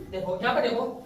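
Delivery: ordinary talk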